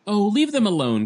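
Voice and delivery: cried out. A narrator's reading. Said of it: "a voice cried over the chanting".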